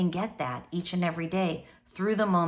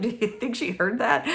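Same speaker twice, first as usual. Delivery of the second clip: laughing husband quote; 5 semitones higher than usual. Retold or imitated. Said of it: "my husband's like".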